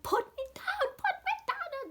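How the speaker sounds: very high, urgent put me down; frantic plea. Retold or imitated. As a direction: plead frantically to be set down. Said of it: "to tell her to put me down".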